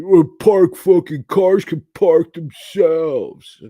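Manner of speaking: slow, high, loud sneering voice; mock the know-it-all customer; mocking quote. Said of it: "I heard all this".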